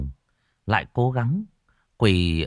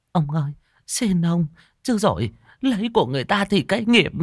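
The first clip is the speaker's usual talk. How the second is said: much higher, hurried feeble plea; faint begging; 11 semitones higher than usual. Acted. Beaten wife begging husband, high and faint. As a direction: gasp out a last warning. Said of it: "bà vẫn cố thều thào".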